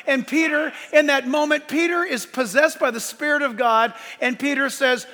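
preacher building momentum; full-force preaching. Acted. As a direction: stack up the answers in a projected pulpit voice.

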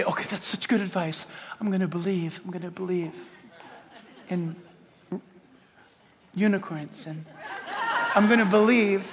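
naive mock believer. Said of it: "you say".

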